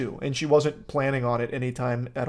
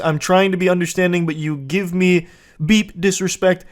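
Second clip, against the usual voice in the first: put-on loud, higher, angry boyfriend reading out his texts; mock demon rant; 3 semitones higher, 6 dB louder than usual. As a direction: read his texts like an angry man barking them. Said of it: "now the demon comes back out again".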